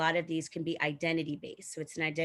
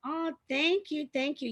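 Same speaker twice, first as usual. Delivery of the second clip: flattered surprise; 11 semitones higher, 4 dB louder than usual. Real, not acted. Guest delighted when the host compliments her hair. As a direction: sound touched and caught off guard.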